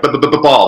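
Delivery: stuttering on the first b of "ball"; childhood stutter. Retold or imitated. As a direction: imitate a stutter, b-b-b-b before ball. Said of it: "because I stuttered as a child".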